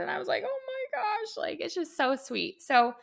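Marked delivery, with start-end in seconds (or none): 0.4-1.4 high, choked-up "Oh my gosh" on the edge of tears; overwhelmed, tearful gasp; teary gasp. Retold or imitated. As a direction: let the voice crack with tears. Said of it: "it brought tears to my eyes".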